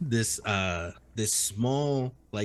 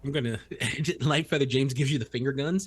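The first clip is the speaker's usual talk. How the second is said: normal talking voice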